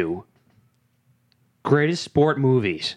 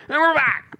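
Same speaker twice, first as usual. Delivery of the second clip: announcer voice; 13 semitones higher than usual. Pretend. Mock show restart.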